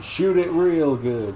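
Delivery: high, slow drawn-out voice; drawled quote voice. Pretend. Put-on voice, slowed quote.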